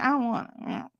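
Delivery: high pouty whine; mock-sulky refusal; pouting. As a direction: whine it through a pout. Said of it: "it's cute because I pout".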